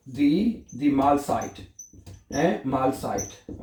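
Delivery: slow, stretched Mahlzeit; slow repetition model. Shown as a demonstration. Teacher slowly repeating Mahlzeit for students. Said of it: "क्या बोलेंगे आप?".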